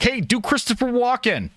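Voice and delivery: high-pitched mock fan voice; pestering fans. Streamer mimicking fans requesting an impression, high pestering voice. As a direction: imitate an eager fan begging for an impression. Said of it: "they're like".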